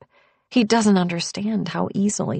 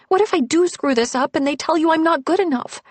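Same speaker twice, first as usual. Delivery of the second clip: higher, faster, fretful; anxious worry; 10 semitones higher, 4 dB louder, faster than usual. Acted. Woman fearing his family will reject her, anxious and fast. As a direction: rush it out, a touch panicked.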